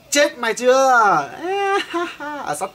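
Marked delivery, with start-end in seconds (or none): 0.1-1.3 gloating taunt. Acted. Gamer taunts opponent. 1.3-2.4 high, sharp triumphant cackle; gloating laugh. Acted. Gamer cackling at his beaten rival, high and triumphant.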